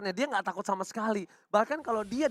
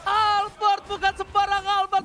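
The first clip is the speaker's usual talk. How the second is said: excited caster; 9 semitones higher than usual. Real, not acted. Caster hypes a retribution steal.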